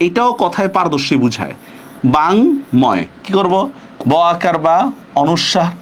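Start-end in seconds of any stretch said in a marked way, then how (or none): 2.2-3.0 বাংময় split slowly as bang, then moy; syllable-by-syllable pronunciation. Shown as a demonstration. Teacher spelling it out for students, বাংময় slowly split in two.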